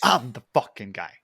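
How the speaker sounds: loud, emphatic boast; Chris D'Elia. Retold or imitated. Comedian insists he is the guy, loud and emphatic. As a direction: hit it hard, drawing out the start. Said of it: "Chris D'Elia was like".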